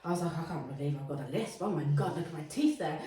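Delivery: ordinary talk, nothing marked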